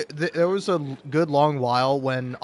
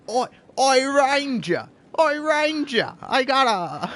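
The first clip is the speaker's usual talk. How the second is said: high, hammed-up Cockney calling out; mock Cockney crow pitched way up; 8 semitones higher, 3 dB louder, slower than usual. Crow hailing the Ranger with gossip, high Cockney accent. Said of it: "like, a Cockney accent".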